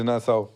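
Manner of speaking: "Nassau" with a non-nasal "au" ending; imitated Spanish mispronunciation. Mocking the coach's ãos, "Nassau" ending in plain "au". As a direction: say "Nassau" for nação, dropping the nasal ão. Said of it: "ele diz os ãos. Não diz, deve dizer Nassau".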